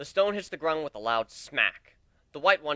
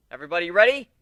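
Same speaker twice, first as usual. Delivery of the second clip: calling out; 4 semitones higher, 12 dB louder, faster than usual. Acted. Calling out before the throw.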